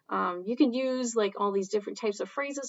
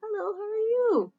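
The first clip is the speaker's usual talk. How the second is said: much higher, bright greeting voice; high friendly hello; cheery greeting; 12 semitones higher, 4 dB louder than usual. Acted. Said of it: "this person over here is the one that's saying hello".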